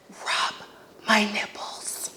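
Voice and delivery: repeated sleazy whisper, slow; mock creepy whisper again. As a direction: hiss it close to her ear.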